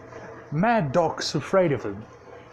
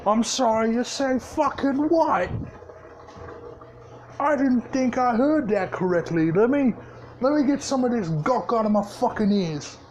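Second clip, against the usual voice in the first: menacing fake disbelief; 8 semitones higher than usual. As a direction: play deaf, then let the threat bite. Orc boss hears mutiny threat.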